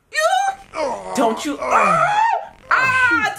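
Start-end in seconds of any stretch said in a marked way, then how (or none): 1.6-3.3 long high squeal; disgusted scream. Real, not acted. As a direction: squeal in revulsion. Reacting in disgust to a pimple.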